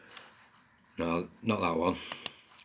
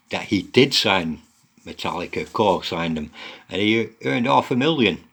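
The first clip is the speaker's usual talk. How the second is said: usual speaking voice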